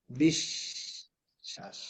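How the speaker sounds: বিশ্বাস whispered very slowly, the শ্ব stretched long. Shown as a demonstration. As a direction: breathe out বিশ্বাস slowly, syllable by syllable. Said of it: "এই দেখো". (বিশ্বাস ধীরে ধীরে ফিসফিস করে টেনে বলা)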